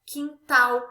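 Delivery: "quintal" with a loud final TAL; stress on last syllable. Shown as a demonstration. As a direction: punch TAL at the end of "quintal". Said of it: "na última sílaba da palavra".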